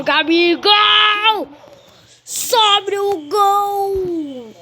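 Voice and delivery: excited commentary. Acted. Commentator calls a shot on goal.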